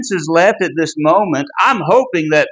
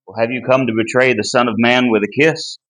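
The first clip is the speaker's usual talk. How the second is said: offhand plain question; 7 semitones lower, faster than usual. Shown as a demonstration. Teacher showing the casual reading of Jesus' question. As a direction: toss it off lightly, as if it barely matters. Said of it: "It's not simply".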